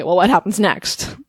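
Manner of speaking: ordinary delivery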